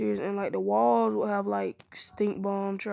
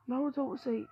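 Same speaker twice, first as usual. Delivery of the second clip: ordinary delivery, nothing marked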